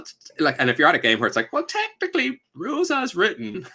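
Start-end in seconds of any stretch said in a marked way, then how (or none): 1.5-3.7 high, nasal-free nitpicking whine; pedantic rules lawyer. Retold or imitated. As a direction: play the smug rules lawyer.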